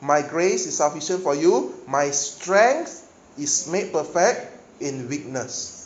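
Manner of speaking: slow, loud, weighty quote; slow emphatic scripture. Acted. Preacher quotes God's powerful statement to Paul, slow and emphatic. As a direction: let each word land slowly. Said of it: "a very powerful statement".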